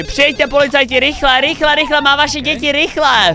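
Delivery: high, pressing plea for help; urgent police call. Acted. Acting the call to police about the children, urgent plea. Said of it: "oni rychle zavolají policii".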